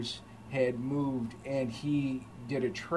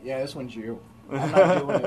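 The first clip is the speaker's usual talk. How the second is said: laughing boss; 7 dB louder than usual. Retold or imitated. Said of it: "my boss was like".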